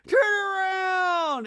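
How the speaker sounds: loud, very high wail; hammed-up hysterical imitation; mock panicked screaming. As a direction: shriek in overdone panic, stretching the last words. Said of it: "they're just all screaming".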